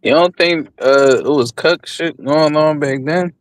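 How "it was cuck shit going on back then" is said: usual speaking voice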